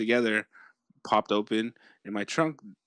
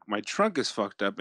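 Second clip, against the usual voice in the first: ordinary talk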